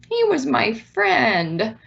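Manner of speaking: put-on high, slow little kid; lightly voiced sad child. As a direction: speak slowly like a heartbroken child. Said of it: "Child might say".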